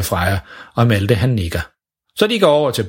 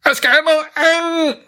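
high, mangled shout with a burned tongue; much higher garbled cry; shouting dad. Acted. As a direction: yell for water while running. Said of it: "råber faren".

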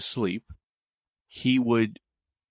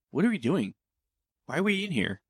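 higher-pitched, puzzled questioning; mock bewilderment; 7 semitones higher than usual. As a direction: play a mildly disoriented friend. Acted friend suddenly disoriented.

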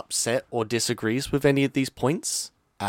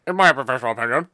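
fast, loud, mock-pompous catchphrase; pompous buzzer catchphrase; 3 semitones higher, 7 dB louder, much faster than usual. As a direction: blurt it like a game-show buzzer. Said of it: "I need that in a buzzer".

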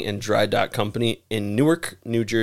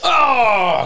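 disgusted yelp; 11 semitones higher, 6 dB louder than usual. Real, not acted. Reacting to sounding rod.

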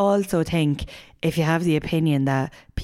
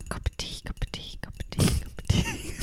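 hushed asmr chant; asmr whisper; 8 dB quieter than usual. Pretend. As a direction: whisper it over and over like an asmr video. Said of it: "So will I do mine".

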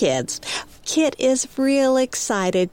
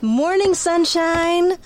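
peppy; 10 semitones higher, slower than usual. Acted. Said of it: "She is peppy".

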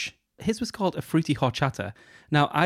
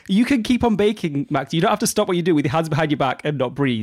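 imagined plea to a baker; 4 semitones higher, 5 dB louder than usual. Retold or imitated. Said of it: "It's like".

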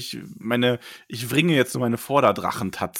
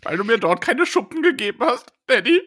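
put-on high, pleading teen whine; whiny teenage son; 6 semitones higher than usual. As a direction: whine up at your father. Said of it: "Ich bin Glaurung".

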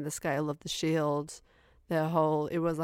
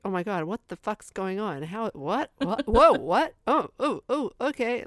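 higher-pitched, bewildered running reaction; mock bewilderment, rising to louder yelps; acted-out confusion; 5 semitones higher, 4 dB louder than usual. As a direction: act out getting more and more lost. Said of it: "I was so confused".

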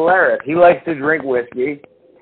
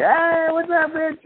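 mock high greeting; 6 dB quieter, slower than usual. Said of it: "He's like".

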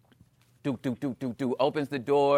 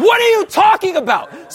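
much higher, loud, laughing disbelief; incredulous shout; 8 dB louder than usual. Retold or imitated. Scientists shouting in disbelief at the result, laughing. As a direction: yell it in disbelief.

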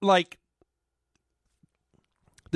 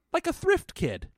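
flat matter-of-fact line; 10 semitones higher than usual. Retold or imitated. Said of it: "I can't even do the delivery".

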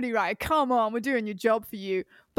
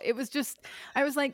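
usual speaking voice

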